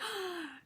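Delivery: high delighted gasp, imitating doctor; a quick sharp intake of breath in wonder; imitated gasp. Doctor sees the tattoo photos, delighted high gasp.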